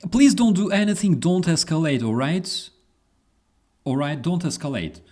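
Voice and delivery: high, loud pleading mock operator; begging the caller not to escalate, mockingly; sarcastic high dispatcher plea; mock 911 dispatcher. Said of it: "they say".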